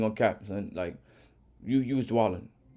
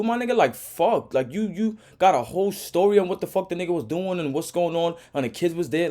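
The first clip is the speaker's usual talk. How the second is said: furious rant; 7 semitones higher, 4 dB louder than usual. Real, not acted. Reaction rant, yelling at driver.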